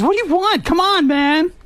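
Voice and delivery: high, impatient mock complaint; annoyed friend being interrupted; irritated. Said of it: "He's irritated".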